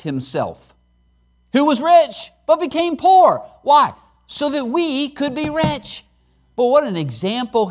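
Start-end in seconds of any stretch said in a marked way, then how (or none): 1.5-3.9 high and loud, building to a peak; preaching climax. Acted. Preacher proclaiming Christ's sacrifice, rising loud. 4.3-6.0 slow, high, softened close; tender resolution. Acted. Preacher to congregation, tender and slow.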